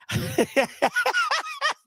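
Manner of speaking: long high-pitched wheezing laugh; high cackling laughter; laughing fit. Real, not acted. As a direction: crack up helplessly. Caller line joke cracks him up.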